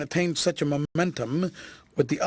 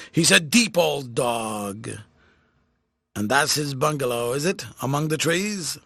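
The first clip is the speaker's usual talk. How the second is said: slow, rough tramp drawl; rough old soldier, slow and sly; gruff old soldier. A narrator's reading. Corporal character voice.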